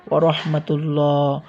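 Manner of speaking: full, dark double l and o-like vowel in রহমাতুল্লাহ; heavy Allah sound. Shown as a demonstration. Teacher showing the rule after pesh, heavy "llaw" in রহমাতুল্লাহ. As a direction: say the end of রহমাতুল্লাহ as a rounded, long "llaw". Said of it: "পেশ অথবা জবর থাকে তাহলে ল হবে".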